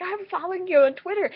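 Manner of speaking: high, loud, starstruck gush; gushing fan. Retold or imitated. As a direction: blurt it out like an overexcited fan.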